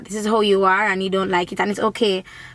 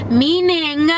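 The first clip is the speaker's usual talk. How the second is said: usual speaking voice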